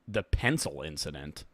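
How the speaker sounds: dropped low, quiet and incredulous; mock deep disbelief. Shown as a demonstration. Streamer repeating the clip title in mock disbelief. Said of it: "repeats the clip title".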